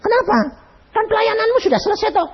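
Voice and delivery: high, quick puzzled asking; puzzled question. Retold or imitated. Husband on the phone to wife, high puzzled asking. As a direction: ask why, baffled. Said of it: "Saya bilang".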